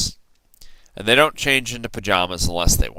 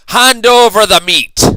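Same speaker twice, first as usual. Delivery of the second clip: much higher, loud demand; pirate demand; 12 semitones higher, 11 dB louder than usual. A narrator's reading. Braidbeard demanding meat at dinner, loud shout. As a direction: bark for the meat. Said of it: "Braidbeard gulped his food and said".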